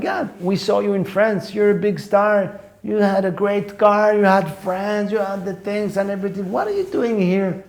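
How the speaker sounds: high-pitched mock disbelief; hammed-up, high-voiced friends pleading for an explanation; incredulous friends. American friends to the comedian who left stardom, high-pitched incredulous imitation.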